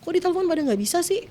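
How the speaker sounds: high-pitched, annoyed demand, retold; retold angry outburst. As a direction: re-enact snapping in reflex anger. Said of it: "karena refleks marah".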